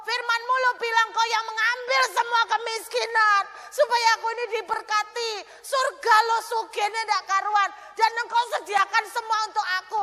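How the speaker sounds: shouted, high, desperate pleading to God; imitating a woman's choleric prayer, shouting. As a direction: cry out to God, demanding. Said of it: "Koleris gini".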